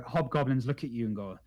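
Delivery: ordinary talk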